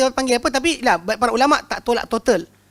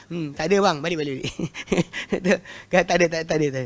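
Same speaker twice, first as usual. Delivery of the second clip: dismissive brush-off; 5 semitones lower, faster than usual. Retold or imitated. Brushing off the overthinking man.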